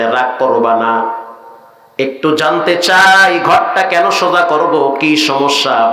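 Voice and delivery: low, slow, soothing answer; calm his angry wife, unhurried and low; calm, gentle reply. Retold or imitated. Mild husband answering his angry wife, low and slow. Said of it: "ঠান্ডা মাথায় বলতে আছে".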